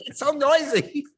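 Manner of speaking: high, loud complaint; mock mother's whine; hammed-up fussing mother; whiny mom.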